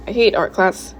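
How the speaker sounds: ordinary delivery, nothing marked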